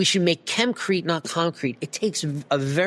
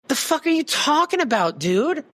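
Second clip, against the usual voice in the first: high-pitched incredulous outburst; passionate exasperation; 10 semitones higher than usual. Real, not acted. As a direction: explode in disbelief at a dumb idea. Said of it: "I'm saying it so passionately".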